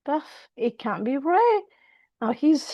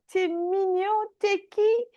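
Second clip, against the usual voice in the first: put-on lightly higher, cooing at a cute kid; cooing stranger; 5 semitones higher than usual. The stranger cooing over little Donald, a touch higher. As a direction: coo down at a little boy. Stranger coos at small boy.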